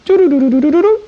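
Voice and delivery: high, rapid sung "doo-doo" signalling beeps; imitated phone tones. Explaining long-distance calls to an audience, imitated phone tones. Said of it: "you used to hear".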